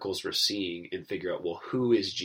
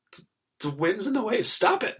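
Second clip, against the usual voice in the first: high, loud scolding command; mock rebuke; 13 semitones higher, 4 dB louder than usual. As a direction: snap the storm into silence. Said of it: "And so Jesus is like".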